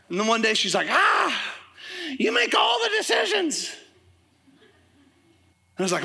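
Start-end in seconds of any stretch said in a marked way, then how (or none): 0.9-3.7 high-pitched, fed-up mock yell; shrill-pitched comic blow-up, imitating the wife; exasperated wife outburst. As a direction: act out a wife bursting with exasperation. Wife's outburst acted in retold quarrel.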